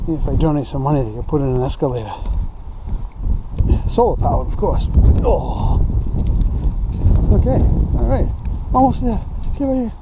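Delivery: winded from the stairs; puffing, short of breath; climbing stairs. Real, not acted. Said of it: "these stairs are killing me".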